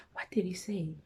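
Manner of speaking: unvoiced whisper, asking herself. Real, not acted. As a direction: whisper the question to yourself as you try to remember. Trying to recall his words.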